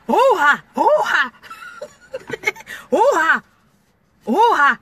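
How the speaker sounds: loud, drawn-out oh ha; hammed-up wail. Driver hamming up a wail to her song, loud. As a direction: wail it for show.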